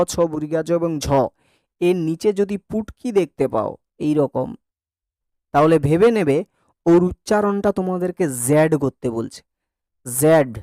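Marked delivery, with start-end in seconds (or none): none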